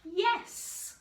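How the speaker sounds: high, loud eager yes; determined mouse. Acted. The mouse deciding to learn to roar, high and loud. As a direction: burst out with eager resolve. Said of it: "thought the mouse".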